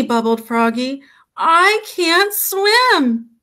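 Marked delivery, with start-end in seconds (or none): 1.4-3.3 put-on high, slow childlike wail; distressed protest. A narrator's reading. Froggy to his mother, high wailing protest.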